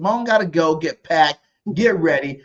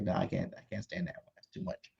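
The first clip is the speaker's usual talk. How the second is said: very soft, dropped-away quote; retold refusal; 4 semitones lower, 20 dB quieter than usual. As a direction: quote him under your breath. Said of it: "He's like".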